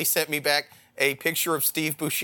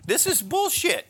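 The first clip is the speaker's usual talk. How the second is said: mock freakout; 7 semitones higher than usual. Meeting his own clone on the street, hammed-up high-pitched shock. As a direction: squeal in fake shock at your own clone. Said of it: "I was like".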